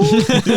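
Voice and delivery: high laugh breaking out; burst of high laughter. Real, not acted. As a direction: laugh at your own bit. Laughing at own impression.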